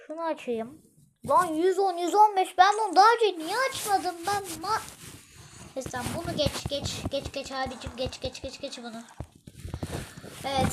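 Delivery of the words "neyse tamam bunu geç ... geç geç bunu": muttering. Real, not acted. Muttering skip skip.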